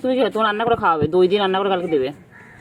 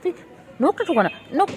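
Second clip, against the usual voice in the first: ordinary talk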